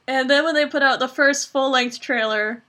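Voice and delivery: usual speaking voice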